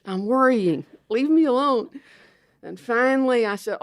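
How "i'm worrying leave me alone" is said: higher, louder mock annoyance; retelling how she told the imagined voices off; exasperated. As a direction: snap back to be left alone.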